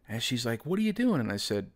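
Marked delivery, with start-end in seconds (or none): 0.7-1.2 high mock girl voice asking; girl's voice. Narrator mimics his ex asking what he is doing, high and girly. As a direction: squeak it up like her.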